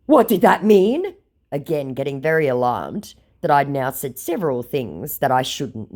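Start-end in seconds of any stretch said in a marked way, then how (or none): 0.1-1.1 mock scammer voice.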